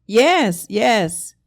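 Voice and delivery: loud confirmation over a bad line; to someone hard of hearing. Real, not acted. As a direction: shout it back to the caller. Answering caller on phone.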